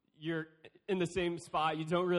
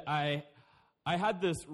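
ordinary delivery

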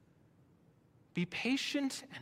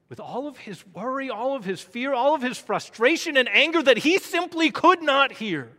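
building preaching; 8 semitones higher, 13 dB louder than usual. Real, not acted. Preacher on Elijah's worry, fear and frustration, building to full force. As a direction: build from firm to loud, high pulpit cry.

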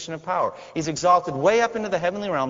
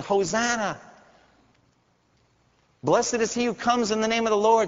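high, joyful acclaim; children crying out; 9 semitones higher than usual. Retold or imitated. Preacher voicing children greeting Jesus, high and joyful. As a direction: cheer like children greeting a king. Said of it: "They're crying out".

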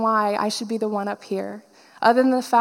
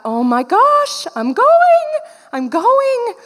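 high, loud reenacted squeal of joy; overjoyed; 12 semitones higher, 6 dB louder, much slower than usual. Speaker learning a class spot is open, overjoyed squeal. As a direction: shoot the pitch up, loud and thrilled.

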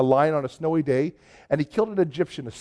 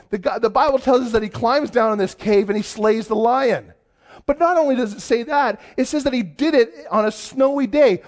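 higher, louder storytelling preach; preaching with energy; 5 semitones higher, 4 dB louder than usual. Acted. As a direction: build the story with sermon fervour.

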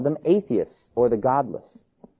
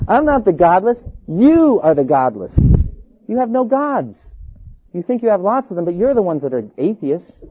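raised, forceful retort; defiant accusation; 5 semitones higher, 4 dB louder than usual. Acted. Polycarp accusing the stadium crowd, loud and defiant. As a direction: throw the charge back, stressing YOU.

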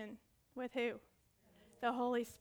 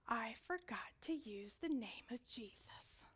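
slow secretive whisper; whispered realisation; 8 dB quieter, slower than usual. Retold or imitated. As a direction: let them in on the secret softly.

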